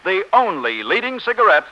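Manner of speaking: ordinary talk, nothing marked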